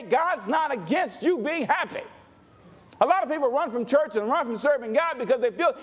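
ordinary talk

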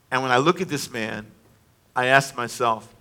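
ordinary talk, nothing marked

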